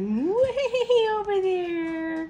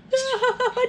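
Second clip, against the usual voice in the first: high bubbly giggle; 7 semitones higher, 5 dB louder than usual. Real, not acted. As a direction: laugh while playing. Playing with pet.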